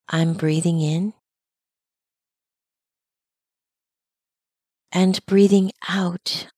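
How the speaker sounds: calm, unhurried meditation guide, long pauses between phrases; measured mindfulness-teacher delivery with lingering silences; guided meditation. Acted. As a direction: guide listeners gently into their breathing, leaving space after each phrase. Leads a breathing meditation.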